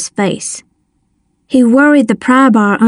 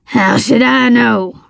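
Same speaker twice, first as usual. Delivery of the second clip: slow villain's snarl; slow, surly character voice; slower than usual. A narrator's reading. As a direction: snarl the retort, drawn out. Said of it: "he snarled".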